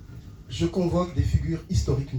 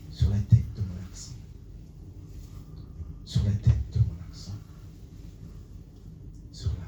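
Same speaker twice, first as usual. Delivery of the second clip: slam poetry recital; 7 dB quieter than usual. Acted. Poet tells the audience of homeland and roots, slam recital. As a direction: recite slam softly, leaving long pauses. Slam poem, hushed opening.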